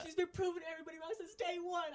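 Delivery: high-pitched excited shouting; sustained high yell; retold screaming. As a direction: shout it in fan triumph. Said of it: "I was screaming out of my lungs".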